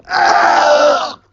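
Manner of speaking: high-pitched strangled wail; choking scream. Acted. Cyril still choking in the background, strangled scream. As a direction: wail as if food is stuck. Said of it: "Cyril chokes in the background".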